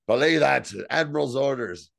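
loud, commanding counter-order, mildly acted; retold yell. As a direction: call out an order as if overruling the crew. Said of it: "and he yells".

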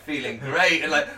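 ordinary talk, nothing marked

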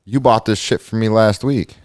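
mock courtroom protest; protesting defendant. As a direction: plead your case to the judge. Skit as defendant protesting to judge.

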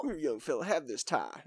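soft, rough presidential impression; hushed mock statesman, badly faked; Bill Clinton. A president offering an intern his tie, soft Clinton impression. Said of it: "That's more Bill Clinton".

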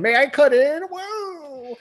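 high-pitched cartoon puppet voice; Pinocchio. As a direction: put on a little high voice, ending in a swooping whoa. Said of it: "Pinocchio swings her away. Cuts in".

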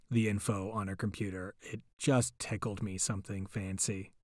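ordinary delivery

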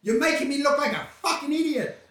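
mock scolding. Scolding the friend.

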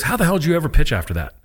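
a touch of amazement; amazed doctor. Retold or imitated. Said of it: "He goes".

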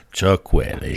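low, booming name read; deep radio voice. Pretend. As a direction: boom it like a DJ. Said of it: "I feel like saying that".

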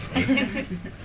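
soft high laugh; quick amused giggle. Real, not acted. Laughing after the joke about God's plans.

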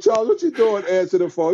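high mock-scolding question; incredulous. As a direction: laugh at him in amazement. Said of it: "I said".

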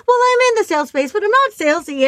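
high, loud, fast self-defence; hammed-up retort; mock indignant protest. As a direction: insist you're not salesy, a little indignant. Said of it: "And I'm like".

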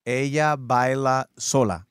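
very slow, careful Ella baila; slow careful repeat. Real, not acted. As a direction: sound out each word like a beginner. Student repeating Spanish slowly.

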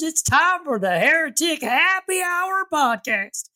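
hollered cowboy Texas drawl, drawn out and high; Texas accent. Acted. Podcast host hollering a welcome to partners, playful Texas accent. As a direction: whoop it up like a Texas rancher, pitch swooping up. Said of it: "little bit of a Texas accent".

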